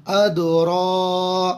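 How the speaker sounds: अद रा with a stretched, heavy ra; drawn-out full ra. Shown as a demonstration. Teacher shows students the heavy stretched ra. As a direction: draw out रा full and long. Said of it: "खींचेंगे भी, पुर भी करेंगे".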